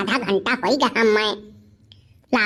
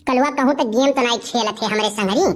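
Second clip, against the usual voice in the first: ordinary talk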